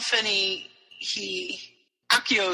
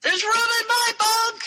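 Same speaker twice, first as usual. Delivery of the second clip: high-pitched acted holler; mock yell at a very high pitch; yelled from next room; 3 dB louder than usual. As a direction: call it out high, as if from another room. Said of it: "Jane yells from the other room".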